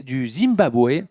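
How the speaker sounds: normal talking voice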